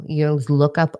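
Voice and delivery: normal talking voice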